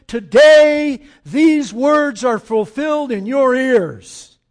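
loud, slow, ringing proclamation; proclaiming. Acted. Jesus telling the crowd, loud slow proclamation. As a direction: announce it to the crowd slowly. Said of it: "he tells the crowd".

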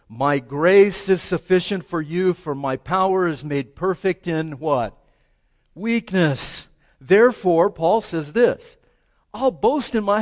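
loud, ringing sermon delivery of scripture; booming preacher voice; preaching at full force. Acted. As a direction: proclaim it to the back row.

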